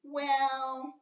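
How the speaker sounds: drawn-out high exclamatory well; an exclaimed "Well."; exclamation. Shown as a demonstration. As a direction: say it like a surprised sigh. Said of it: "you think somebody saying".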